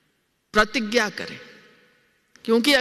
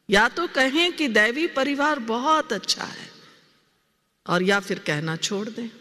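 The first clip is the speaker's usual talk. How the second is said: usual speaking voice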